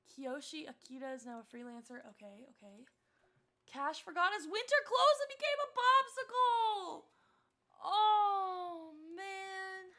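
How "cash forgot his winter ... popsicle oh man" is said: high, loud incredulous read-out; dismayed disbelief. Real, not acted. Streamer reading how a Sim froze, loudly dismayed. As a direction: wail the news, drawing out the end. Reads absurd death notice aloud.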